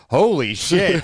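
high, startled "holy shit"; mock astonishment. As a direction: blurt it like the tree just hit you. Retold reaction to tree scene.

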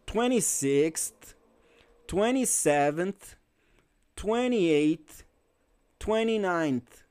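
slow "Twenty-sixth, twenty-seventh, twenty-eighth, twenty-ninth", each ending in a little puff of air; breathy final TH. Shown as a demonstration. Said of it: "toda vez tem um ventinho no final".

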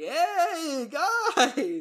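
hammed-up jolly uncle at the door; jovial uncle greeting. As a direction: greet the arriving family with a big laugh. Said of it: "he's like".

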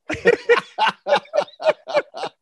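high-pitched burst of laughter; big laugh. Real, not acted. Guest joking that he starts to worry, laughing hard. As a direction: laugh long and high at your own joke.

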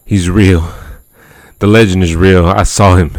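panicked, urgent insistence. Acted. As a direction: blurt it out in terror. Frantic report to the deputy.

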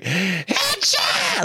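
high, drawn-out mock yell; imitated announcer yell. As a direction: stretch it out like a game announcer's yell, without real volume. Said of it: "like when he yells".

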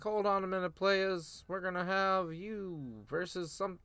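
high, slow mock announcer drawl; fond imitation of the GM interrupting the show; Teddy Long impression. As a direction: draw it out like the old GM stopping a match. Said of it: "he comes out and he's like".

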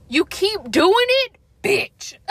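loud, high, fed-up yell; angry outburst. Real, not acted. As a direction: blow up at him for ignoring you. Ranting at partner who ignores limits.

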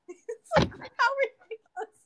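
laughing. Real, not acted. Laughing at the silly line.

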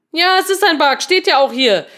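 high, loud, exasperated agreement; exasperated retort. Acted. Reading the message to a colleague, exasperated retort. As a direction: snap back that it is obvious.

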